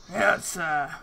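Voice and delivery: rough gravelly growl, held back; gruff gravelly voice. Acted. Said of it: "in a very gruff, sort of gravelly voice".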